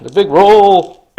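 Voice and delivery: slow, high, loud build to the roll; dramatic announcer. Acted. Announcing the big dice roll, slow hype. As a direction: stretch it out like a trailer voice.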